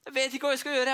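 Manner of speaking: panic, no answer to give; frightened skipper admitting he's lost; scared. Acted. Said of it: "Jeg var så redd".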